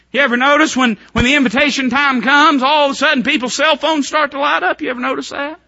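high, loud mocking preaching; fiery pulpit preaching; preaching at full force. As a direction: rail at the pew-leavers.

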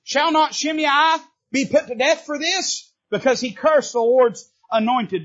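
high, slow, indignant demand; Abishai demanding death. Acted. Abishai urges David to kill Shimei, indignant and high. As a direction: Abishai calls for Shimei's head. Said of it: "and this is what he said".

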